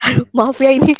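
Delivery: laughing through an apology; real amused laughing. Real, not acted. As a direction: break into giggles while apologising. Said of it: "saya ngebaca komen-komennya lucu". (tertawa geli sambil minta maaf)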